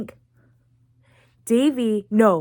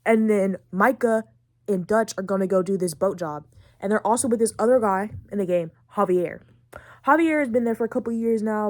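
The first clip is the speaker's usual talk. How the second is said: ordinary talk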